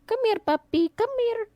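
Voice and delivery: high, sing-song puppy call; coaxing a puppy. Retold or imitated. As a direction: coax the dog over in a cooing voice. Mom calling the puppy.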